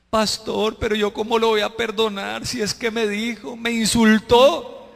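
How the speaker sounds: mock aggrieved whine; complaining congregant. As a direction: protest to the pastor, dragging out the hurt. Congregant objects to forgiving, acted.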